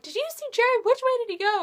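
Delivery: high-pitched urgent fangirl voice; frantic fangirls. Retold or imitated. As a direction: imitate fans chasing a star. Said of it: "They're like".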